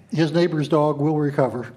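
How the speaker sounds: usual speaking voice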